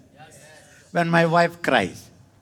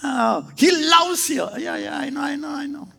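mock impatient reply; 11 semitones higher than usual. Mocks jaded response.

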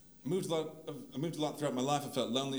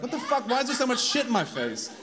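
mock shock; 8 semitones higher, 6 dB louder than usual. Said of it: "I was like".

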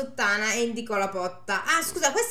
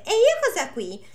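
coy daughter; 10 semitones higher, 5 dB louder than usual. Acted. Daughter voiced.

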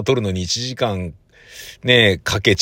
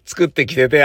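normal talking voice

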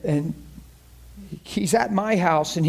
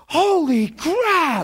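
amazed exclamation; 5 semitones higher, much slower than usual. Retold or imitated. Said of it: "she goes".

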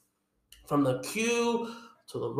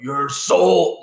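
half-hearted mummy villain, a bit louder; Imhotep; 5 dB louder than usual. Acted. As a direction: give a quick sample of the mummy's threat. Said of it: "your flawless emo up-temp impression".

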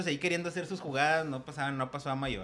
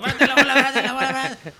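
shouting orders across the pitch, voice raised and higher; 8 semitones higher, 8 dB louder than usual. Retold or imitated. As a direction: yell it like a frantic captain. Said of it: "se vuelve loco gritando".

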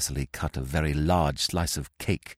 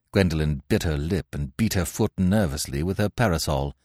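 plain reading voice

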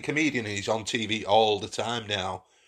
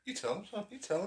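high cheering voice; 12 semitones higher, 10 dB quieter than usual. Acted. Dad cheering son on.

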